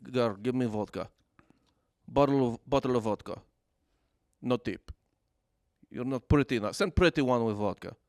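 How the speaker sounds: lightly put-on Russian accent, broken English; gruff Russian bar patron barking orders, lightly. Russian patron ordering vodka at Austin bar, mock Russian accent. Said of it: "I did that voice".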